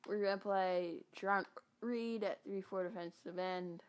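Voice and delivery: ordinary talk, nothing marked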